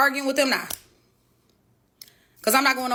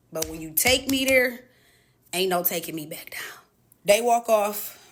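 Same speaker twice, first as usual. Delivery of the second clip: usual speaking voice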